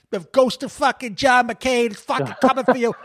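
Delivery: mock a blustering New York actor threatening Trump; Michael Rappaport impression. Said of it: "Michael Rappaport was there being like".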